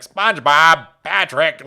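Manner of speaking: lightly voiced Squidward impression; a hint of Squidward, calling out the names; mediocre Squidward. Shown as a demonstration. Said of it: "my Squidward is so mid".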